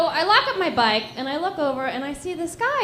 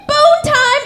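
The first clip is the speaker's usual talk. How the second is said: loud, high, drawn-out shout of triumph; excited outburst; 13 semitones higher, 9 dB louder, slower than usual. Acted. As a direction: shout it, thrilled that it's finally happening. Acting out her excited reaction.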